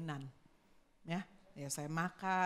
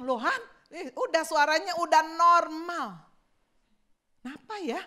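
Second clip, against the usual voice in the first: surprised delight; 6 semitones higher, 8 dB louder than usual. Retold or imitated. Amazed voice is back.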